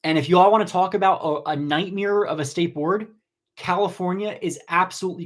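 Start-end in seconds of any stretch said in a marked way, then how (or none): none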